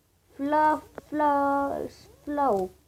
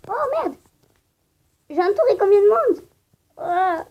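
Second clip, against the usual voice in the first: excited shout; 7 semitones higher, 11 dB louder than usual. Real, not acted. Game tension peaks.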